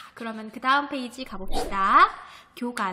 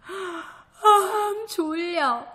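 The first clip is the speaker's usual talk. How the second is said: sleepy yawn; 7 semitones higher, 4 dB louder, much slower than usual. Acted. Girl yawns sleepily in story.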